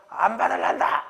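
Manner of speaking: mock-outraged kid turning it down, loud; defiant child refusal. Child rejecting a thousand-won monthly allowance, loud mock defiance. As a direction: refuse it loudly like an indignant child.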